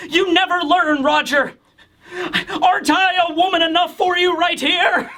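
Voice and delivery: high, hammed-up lovesick plea; mock pleading. Gloriosa begging Roger to notice her, hammed-up lovesick plea. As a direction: overplay the desperate admirer.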